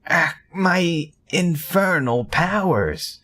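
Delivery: high, slow hammed-up lament; mock dismay. Witch mourning her infernal powers, hammed up and high. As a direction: wail over lost powers, overdone.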